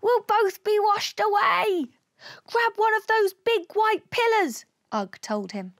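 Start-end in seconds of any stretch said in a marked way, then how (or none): 0.0-1.9 high-pitched panicked wail; panicky, high alien voice; alarmed cry. A narrator's reading. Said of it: "cried Og". 2.5-4.7 high, urgent command; urgent order. Acted. Alien orders friend amid flood.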